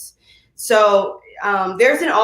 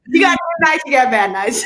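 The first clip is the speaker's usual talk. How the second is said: laughing; 7 semitones higher than usual. Real, not acted. Laughing at her [name] slip.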